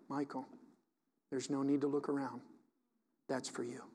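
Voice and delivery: very soft, low voice of God imitated; subtle, gentle. God privately nudging a listener in church, subtle and gentle. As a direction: drop right down to a hushed, private correction. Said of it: "He just says it in a subtle way".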